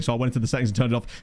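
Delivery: usual speaking voice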